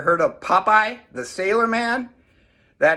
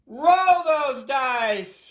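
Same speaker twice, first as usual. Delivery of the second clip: drawn-out excited call; 8 semitones higher, much slower than usual. Real, not acted. Coach announcing the dice roll.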